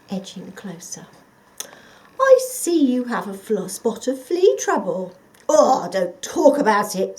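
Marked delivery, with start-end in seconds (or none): 2.2-5.1 loud, higher fox voice, sly; a fox sweet-talking the dog. Acted. The sly fox sizing up the dog, louder and higher. 5.5-7.2 gruff dog. Acted. The watchdog answering the fox, loud dog voice.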